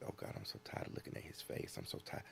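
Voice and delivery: breathy private whisper, barely audible; whispered grumble about a houseguest. Retold or imitated. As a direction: mutter it under your breath so no one hears. Private grumbling behind the scenes.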